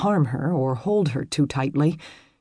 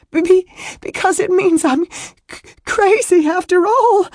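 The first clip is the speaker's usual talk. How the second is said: very high, stammering wail building louder; loud sobbing; 8 dB louder than usual. A narrator's reading. Woman telling him why she is so distressed, sobbing. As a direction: wail it out, catching on words. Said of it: "Her sobs grew louder".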